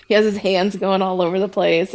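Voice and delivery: ordinary talk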